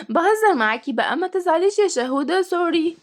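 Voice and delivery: put-on high giggly child voice; laughing, coaxing little girl. A narrator's reading. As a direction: giggle through a playful apology. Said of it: "وضحكت تيا".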